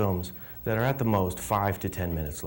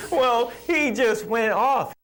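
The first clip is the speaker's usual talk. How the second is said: high, slow, laughing exclamation; 12 semitones higher, 4 dB louder, slower than usual. Real, not acted. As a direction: burst out laughing while saying it. Laughing recalling Steve's outburst.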